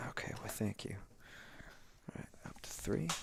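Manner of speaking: whispered under breath; whispering. Real, not acted. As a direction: mutter it in a whisper. Muttering while playing.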